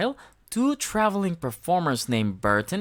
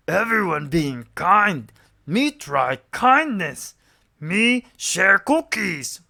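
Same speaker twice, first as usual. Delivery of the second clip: louder, slow monster voice; Cookie Monster voice; 4 semitones higher, 6 dB louder, slower than usual. A narrator's reading. Cookie Monster sharing cookies, slow and loud. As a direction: rumble it out like a cookie-loving monster.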